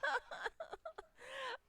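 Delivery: laughing till near tears; high squealing laugh. Real, not acted. Player laughing at the DM's joke, high squeal. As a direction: shriek with laughter.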